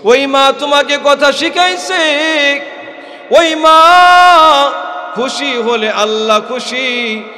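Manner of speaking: high, loud preacher's cry; impassioned shout. Acted. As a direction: cry it out to the crowd. The line repeated, then shouted.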